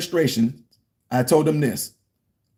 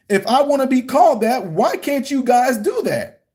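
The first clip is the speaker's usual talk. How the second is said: higher-pitched, indignant challenge; retold protest; 8 semitones higher than usual. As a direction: plead the case in a raised pitch, drawing out the end. Protesting to the administration in retold argument.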